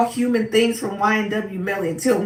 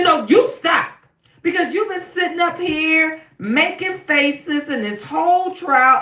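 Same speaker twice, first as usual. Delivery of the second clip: higher, shouted rebuke; a judge shouting down a lawyer, higher-pitched rebuke; scolding judge; 6 semitones higher than usual. Retold or imitated. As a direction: tell her off in front of the court.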